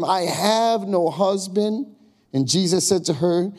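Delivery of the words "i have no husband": higher, slow, defensive "I have no husband"; lightly voiced woman. Samaritan woman answering Jesus, lightly voiced. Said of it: "The woman answered to him".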